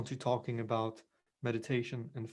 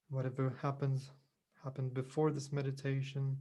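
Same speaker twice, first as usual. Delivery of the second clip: unhurried meditation voice with long pauses; guided meditation; 5 dB quieter than usual. Real, not acted. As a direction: gently guide them into calm, leaving space between phrases.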